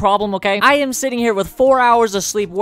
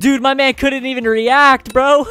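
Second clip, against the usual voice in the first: excited; 5 semitones higher than usual. Real, not acted. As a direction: crow over the win. Laughing after kill.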